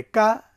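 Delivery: কা said light and soft; a thin, soft কা. Shown as a demonstration. Teacher models the light kaf for students. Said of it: "নরম করে".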